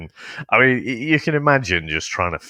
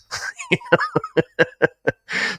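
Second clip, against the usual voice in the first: real laugh, much higher. Real, not acted. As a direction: burst into high laughter. Laughing at the dog scene.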